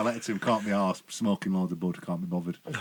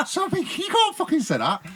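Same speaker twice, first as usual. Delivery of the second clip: outraged; 5 dB louder than usual. Retold or imitated. Outraged at the steward's insult.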